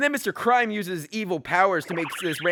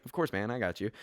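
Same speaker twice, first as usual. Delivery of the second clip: deep soft hero voice; 9 semitones lower, 8 dB quieter than usual. Acted. Hero agrees to help.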